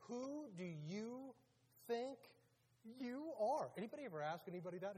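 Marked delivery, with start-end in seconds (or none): 0.1-3.7 slow, high, word-by-word challenge; challenging demand. Acted. Religious leaders confront Jesus, slow indignant challenge. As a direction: space the words out in acted indignation.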